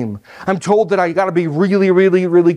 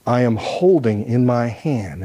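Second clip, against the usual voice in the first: ordinary delivery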